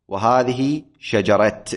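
"شجرة" ending in t, shajarat; slow demo of "شجرة" with final t; final t kept. Shown as a demonstration. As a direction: stop "شجرة" on a clear t. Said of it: "يقف بالتاء".

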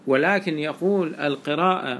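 normal talking voice

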